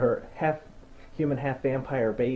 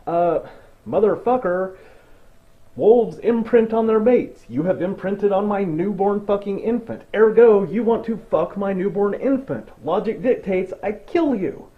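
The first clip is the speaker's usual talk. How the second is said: loud, higher outraged tirade; outraged rant; 6 semitones higher, 5 dB louder than usual. Retold or imitated. Upset mother ranting at the wolf, loud outraged tirade. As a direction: rant at him, worked up and loud. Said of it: "I'd be pretty upset too".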